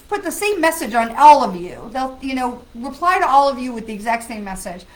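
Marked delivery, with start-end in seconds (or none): none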